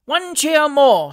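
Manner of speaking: high, loud, drawn-out cry; screamed rallying call. A narrator's reading. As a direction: whip the crowd into another cheer. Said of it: "screamed the little fugleman".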